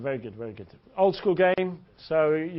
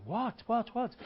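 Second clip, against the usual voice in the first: high, soft startled question; mock alarm; 8 semitones higher, 5 dB quieter, slower than usual. Girl reacts to his palm reading, mock alarm. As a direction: play her taken aback. Girl's acted alarmed reply.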